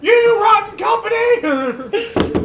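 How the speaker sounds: slow, high, loud cranky yell; old man yelling. Retold or imitated. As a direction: drag it out shaking your fist. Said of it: "screaming, shaking his fist".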